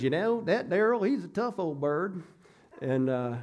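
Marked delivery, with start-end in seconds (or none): 0.0-2.2 a hint of a Kentucky coworker; Kentucky accent. Coworker teasing the preacher, light Kentucky accent. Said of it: "imitate his Kentucky accent".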